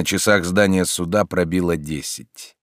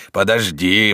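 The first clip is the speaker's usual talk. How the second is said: growl; 4 dB louder than usual. A narrator's reading. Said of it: "ворчал он, скрежеща зубами от злости".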